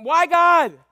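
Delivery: high, loud pleading wail; Jesus crying out from the cross; anguished cry. Retold or imitated. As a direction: wail it out to God in pain. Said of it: "He went".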